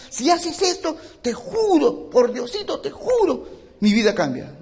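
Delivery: a believer mockingly swearing to change if God helps; mock bargaining prayer. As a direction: ham up a desperate promise to God.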